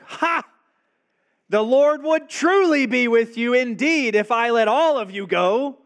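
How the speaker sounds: high, loud mocking scoff; sarcastic outburst. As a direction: sneer it at them with a scornful laugh. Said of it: "sarcastically bursts out".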